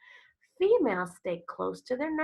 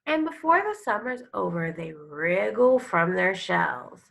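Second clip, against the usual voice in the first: louder, expressive reading of a turtle picture book; storybook read-aloud; 7 dB louder than usual. Acted. Teacher reading a turtle book to her class, expressive storytelling. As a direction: read it like a nature story for kids.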